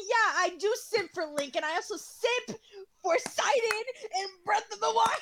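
excited. Real, not acted. Excited gushing confession, voice cracking.

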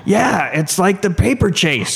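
put-on old man's voice. Old producer musing to the executives, lightly voiced old man. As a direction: play the old producer, wavering and slow. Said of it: "the old producer goes".

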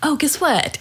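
excited news-breaking. Retold or imitated. Retelling offer of good news.

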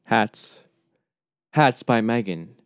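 usual speaking voice

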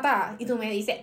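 usual speaking voice

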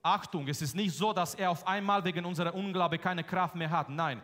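usual speaking voice

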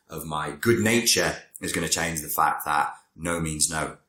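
ordinary talk, nothing marked

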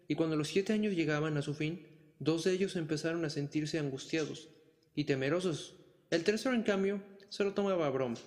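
ordinary delivery

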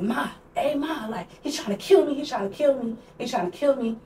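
high-pitched retold cry for help to mom; frantic plea. Retold frantic phone call to mom.

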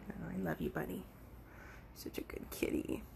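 soft, low, half-whispered affection; tender cooing. Real, not acted. Vlogger telling the cat it is loved, soft tender murmur. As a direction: murmur it fondly, trailing into a whisper.

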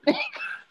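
very high, soft giggle; real laughter. Real, not acted. Joking about party bruises, high laughter.

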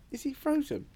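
lightly mocking the friend wondering if he froze; anxious friend. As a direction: mimic a worried friend in a high voice. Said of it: "hearing you going".

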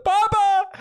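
put-on crying child. Acting child hit on ride.

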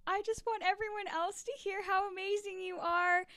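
high, gushing and effusive; gushing excited quote. Retold or imitated. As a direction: gush like a proud fan. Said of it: "we say it just like that quite often".

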